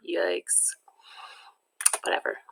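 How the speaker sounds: breathy whispered aside; whispered yikes. Acted. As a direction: drop to a whisper for the jab. Wizard club put-down aside.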